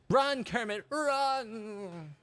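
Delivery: high, drawn-out "run"; urging cry. Acted. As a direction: cry out high, stretching the last word. Cheering on Kermit in game.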